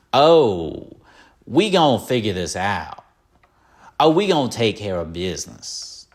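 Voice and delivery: hammed-up cowboy bravado; jokey mock movie-hero boast; Clint Eastwood impression. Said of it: "He doesn't talk like that".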